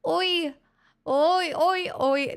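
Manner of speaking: put-on high Irish-accented oi's. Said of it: "I now have to do it in Irish".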